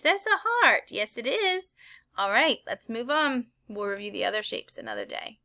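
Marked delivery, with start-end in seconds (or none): 0.0-0.8 excited. Shown as a demonstration. Excited repeat of answer.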